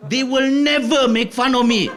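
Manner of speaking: high, loud, slow mock-heroic speech; indignant declaration. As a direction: build into a grandiose declaration of loyalty. Child indignantly defends his friends.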